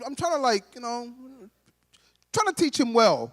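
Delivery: ordinary talk, nothing marked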